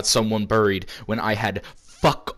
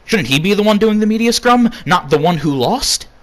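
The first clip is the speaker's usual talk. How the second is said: indignant rant; 9 semitones higher, 6 dB louder than usual. Acted. Ranting about absent main eventer.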